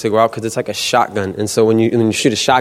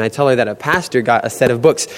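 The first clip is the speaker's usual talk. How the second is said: ordinary delivery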